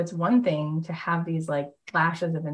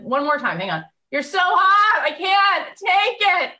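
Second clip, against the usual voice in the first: swooning squeal; 7 dB louder than usual. Retold or imitated. Host tells guest he's hot, high loud swooning squeal. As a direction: squeal like a starstruck fan who can't cope. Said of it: "I was like".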